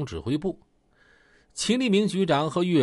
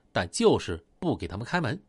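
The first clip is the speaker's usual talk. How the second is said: ordinary delivery, nothing marked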